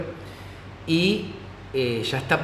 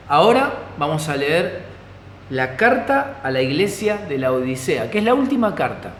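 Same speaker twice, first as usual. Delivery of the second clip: ordinary delivery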